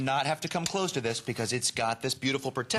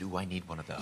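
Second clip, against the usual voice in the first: mock longing; 7 semitones lower, 7 dB quieter, faster than usual. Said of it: "I said".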